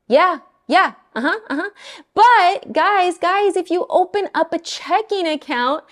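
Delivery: mimicking the lender's eager pitch; mock salesy lender. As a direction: ham up a pushy loan officer.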